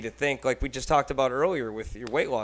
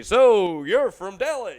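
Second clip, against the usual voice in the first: slow, higher, much louder announcer delivery; radio host voice; 8 semitones higher, 11 dB louder, slower than usual. Shown as a demonstration. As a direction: ham up a cheesy radio interviewer, drawn out. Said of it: "talking to radio voice".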